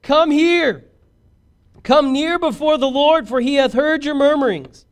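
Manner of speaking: high, loud holler to a crowd. Acted. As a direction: call the whole congregation in, loud and high. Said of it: "he says with a loud voice".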